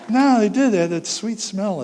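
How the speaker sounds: high, loud protest; loud insistence. Real, not acted. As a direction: insist it's true. Insisting against the men.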